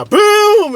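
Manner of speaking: loud, high shouted boom; imitated explosion. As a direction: burst out with the blast. Imitates the bomb blast.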